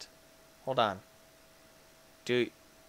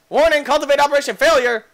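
high, loud, fast warning; alarm announcement; 10 semitones higher, 10 dB louder, faster than usual. Pretend. Player announcing the machine's failure, urgent alarm. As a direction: rattle off an urgent alert loudly.

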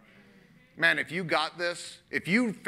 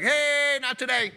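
Job backing down; 8 semitones higher than usual. Acted. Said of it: "Job was like".

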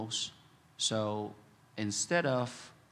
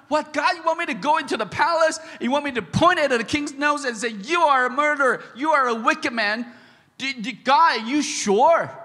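much higher and louder, alarmed disbelief; panicked protest; 14 semitones higher, 12 dB louder than usual. Pretend. Preacher as Nathan pleading with God in high alarmed disbelief.

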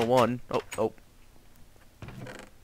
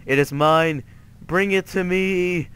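mock greedy demand; 5 semitones higher, 4 dB louder than usual. Mock craving for a donut.

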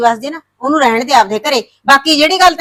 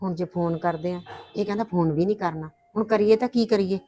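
hushed worry; 4 semitones lower, 13 dB quieter than usual. Acted. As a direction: ask it under your breath. Worried mother talks privately. (ਹੌਲੀ ਫ਼ਿਕਰਮੰਦ ਆਵਾਜ਼)

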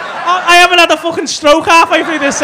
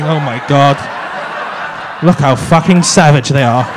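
lower, prissy mock-posh Southerner gasping at the locals; 10 semitones lower than usual. Mocking the Southern guests, gasping at Scousers in a lower mock-posh voice. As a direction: sneer it like a shocked Southerner, lightly voiced. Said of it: "yous are the Southerners, just like".

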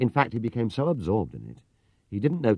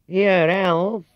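much higher and louder, calling inside; 12 semitones higher, 10 dB louder than usual. Acted. Clerk calling to the owner, loud. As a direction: call to someone indoors. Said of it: "he called".

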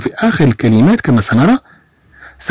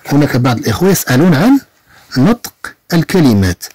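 ordinary talk, nothing marked

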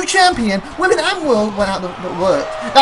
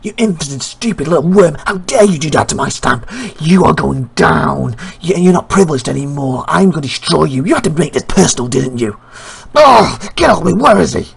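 low, threatening promo voice; enraged wrestler villain; 6 semitones lower, 3 dB louder than usual. Acted. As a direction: threaten your rival in a deep angry voice.

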